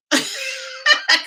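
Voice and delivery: high burst of laughter. Real, not acted. Recalling her friend's reaction, high laugh. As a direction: laugh hard at the memory.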